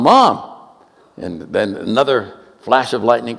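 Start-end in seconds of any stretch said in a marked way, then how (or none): 0.0-0.4 put-on a kid's high, loud call; child calling out. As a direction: call out to mom in the storm. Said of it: "he starts calling his mom".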